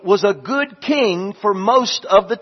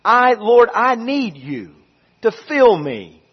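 prayer; 4 semitones higher, 4 dB louder than usual. Acted. Pastor praying aloud before the sermon, earnest and fervent. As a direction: pour out each I love you to God.

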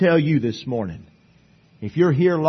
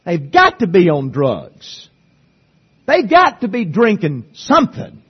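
jailer in disbelief; 3 semitones higher, 4 dB louder than usual. Retold or imitated. Jailer voicing bewilderment.

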